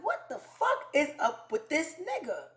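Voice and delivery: high, swooping incredulous question; exasperated singsong disbelief; mock bewilderment. Younger self fed up with cancellations, bewildered.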